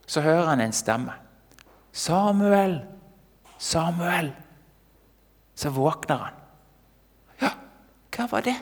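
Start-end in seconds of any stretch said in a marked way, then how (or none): 1.9-4.3 high, drawn-out call of a name. Acted. A voice calls the sleeping boy. 7.4-8.5 lightly acted, higher-pitched puzzled boy; a hint of a boy roused from sleep; startled waking boy. Boy wakes to a voice.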